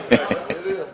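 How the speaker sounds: a short high laugh. Real, not acted. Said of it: "Here you go with that shit again".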